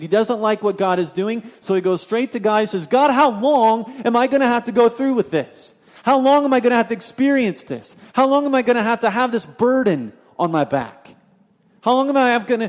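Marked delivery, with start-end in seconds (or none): 2.9-12.7 higher, louder angry cry of How long; frustrated outcry. Acted. Habakkuk shouting at God in frustration, loud angry outcry. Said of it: "he's mad. He's upset. He's frustrated".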